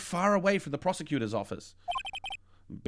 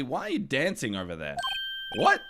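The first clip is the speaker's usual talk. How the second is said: ordinary talk, nothing marked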